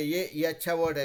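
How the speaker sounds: ordinary talk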